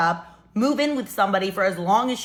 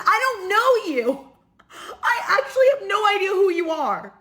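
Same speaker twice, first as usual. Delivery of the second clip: exasperated high shout; 10 semitones higher, 3 dB louder than usual. Retold or imitated. To a stranger crashing her apartment party, a high exasperated cry-shout. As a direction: yell it high at a stranger, voice cracking.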